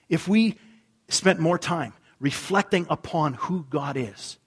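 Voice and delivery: loud, pressing sermon delivery; preaching at full force. Acted. Pastor urging his church to reflect on God, loud and forceful. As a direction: drive the point home from the pulpit.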